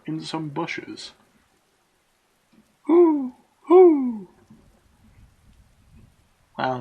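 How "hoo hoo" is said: high loud owl hoots. Acted. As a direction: hoot like an owl. Owl calls in the bushes.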